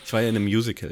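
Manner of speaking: usual speaking voice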